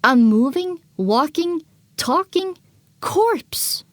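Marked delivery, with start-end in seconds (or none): none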